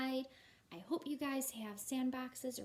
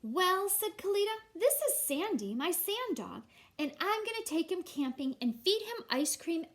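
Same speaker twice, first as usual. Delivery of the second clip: put-on much higher, louder proud little girl; Kalita the girl; 7 semitones higher, 8 dB louder than usual. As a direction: announce your sand dog like a proud kid. Girl Kalita speaks in story.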